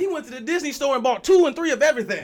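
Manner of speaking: high, loud burst of hype; excited exclaiming. Real, not acted. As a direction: yell it out in amazed agreement. Excitedly backing up a friend's story.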